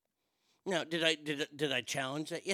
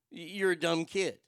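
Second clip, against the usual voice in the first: ordinary talk, nothing marked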